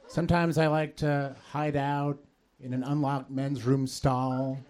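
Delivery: normal talking voice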